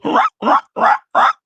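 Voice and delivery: put-on very high breathy squeak of a baby dragon; tiny high-pitched chirp. Hatchling chirps beside you, a tiny high squeak. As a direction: squeak like something small and new. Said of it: "this, like, little high-pitched sound".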